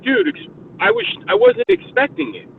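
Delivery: high, strained protest; hammed-up defensive squeak. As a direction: push back, voice jumping up. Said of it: "I'm like".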